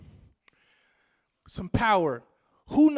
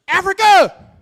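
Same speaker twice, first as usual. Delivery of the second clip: rallying shout; 9 semitones higher, 14 dB louder, slower than usual. Real, not acted. Speaker rallies the audience.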